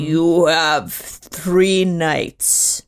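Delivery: very slow, low, breathy vampire drawl; Nosferatu impression. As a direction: stretch it out like a creeping undead count. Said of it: "Can you give me your best Nosferatu impression".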